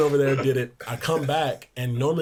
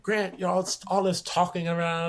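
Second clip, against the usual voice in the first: high mock scolding; imitating annoyed coach; 6 semitones higher than usual. Coach Barnes scolding Grant's talking, high mock scold. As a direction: scold the trash talk, pitched up. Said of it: "It's like".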